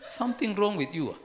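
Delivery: loud, hostile mock snap; grumpy retort. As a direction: bark it back at the friendly greeter. Repeating the grumpy reply.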